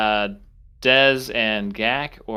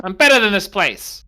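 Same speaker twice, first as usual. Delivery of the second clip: louder, higher boast with a laugh in it; proud parting shot as a goblin; defiant goblin outburst; 4 semitones higher, 6 dB louder than usual. Acted. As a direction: goblin storming off, loudly scornful. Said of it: "He turns around and goes".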